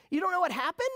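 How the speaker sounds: high, loud, incredulous question; can't believe he doesn't know; stunned disbelief. Retold or imitated. Hipster fan in the bar can't believe him, loud high disbelief. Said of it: "And he just goes".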